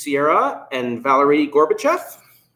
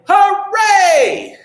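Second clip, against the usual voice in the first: high, loud cheer of hooray; shouted hooray; 6 dB louder than usual. Pretend. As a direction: cheer it out to the kids. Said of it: "shout hooray".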